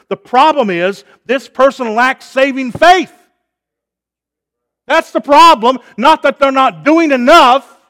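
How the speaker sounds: loud, high preaching voice, hammering each point; full-force preaching. Acted. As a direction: preach it out to the whole hall at full power.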